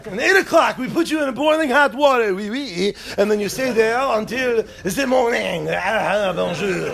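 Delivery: mock French accent. French hell's keeper announcing the boiling water, mock French accent. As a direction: play a gleeful Frenchman with a cartoon French accent.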